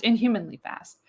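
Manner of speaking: ordinary talk, nothing marked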